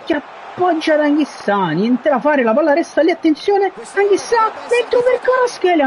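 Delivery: rising, urgent play-by-play; excited commentary. Real, not acted. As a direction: call the chance with building excitement. Gamer commentating a near chance.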